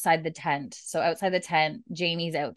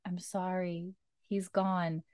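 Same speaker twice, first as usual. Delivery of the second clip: much softer, gentle apology; soft sad news; 9 dB quieter, slower than usual. Retold or imitated. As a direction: quietly tell him he's gone. Claire breaking the news.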